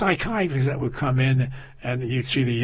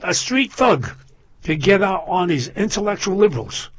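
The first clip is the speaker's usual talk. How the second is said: usual speaking voice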